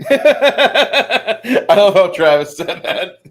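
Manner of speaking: loud, high laughing remark. Real, not acted. Game master cracking up at a player's line, laughing. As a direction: say it through a big laugh.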